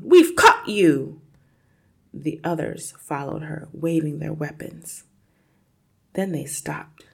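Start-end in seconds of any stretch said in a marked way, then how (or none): 0.0-1.2 loud, slow, drawn-out challenge; triumphant yell. A narrator's reading. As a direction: announce the capture at full voice. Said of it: "she yelled".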